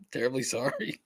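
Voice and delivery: giggling through a fake apology; laughing mock apology. Real, not acted.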